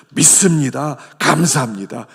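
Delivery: loud confident declaration; proclaiming aloud. Acted. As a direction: call it out boldly. Congregation's proclaimed response. (힘차게 외치듯)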